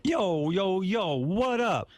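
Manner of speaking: high, drawn-out hammy greeting; mock street-slang wannabe. Shown as a demonstration. Host demonstrating the role he's pushing, high mock street voice. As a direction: stretch it out in a put-on slang voice. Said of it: "can't you be a wigger?".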